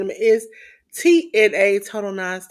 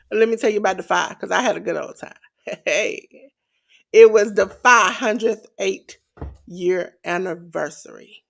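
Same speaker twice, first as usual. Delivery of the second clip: normal talking voice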